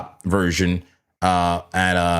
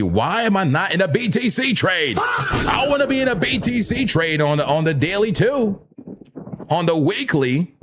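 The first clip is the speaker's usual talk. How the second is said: excited shout; 11 semitones higher than usual. Real, not acted. To his viewers, wishing he were in a BTC trade, excited shout. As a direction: push it up, half-laughing, begging himself.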